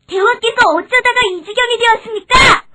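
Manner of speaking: high, loud little mouse voice; alarmed mouse. Acted. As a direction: exclaim in dismay at the king's plight. Mouse finds trapped lion. (높고 큰 소리로 놀란 생쥐)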